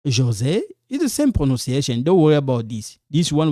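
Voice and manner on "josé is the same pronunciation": ordinary talk, nothing marked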